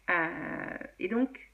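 ordinary talk

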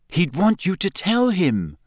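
firmly correcting Harry; stern. A narrator's reading. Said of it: "said Hermione sternly".

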